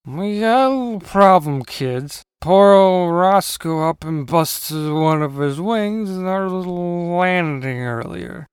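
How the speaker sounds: slow, drawn-out character voice; slow drawling uncle; folksy uncle character. Acted. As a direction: stretch the words out as the uncle. Uncle explains the injury.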